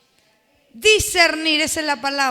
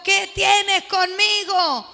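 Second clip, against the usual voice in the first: demon crying out; 3 semitones higher, 4 dB louder, much slower than usual. Acted. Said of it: "exclamó con gran voz".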